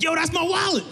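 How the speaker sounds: high, shouted accusation; a stranger yelling to claim his wallet; mock outraged yell; angry yell. Stranger yells in dream.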